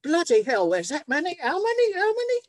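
retold shock at the meetings list; shocked disbelief. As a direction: gasp out the numbers in disbelief.

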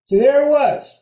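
loud, high dramatic punchline; emphatic announcement. Real, not acted. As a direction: hit it like a reveal. Dramatic moment of arrival.

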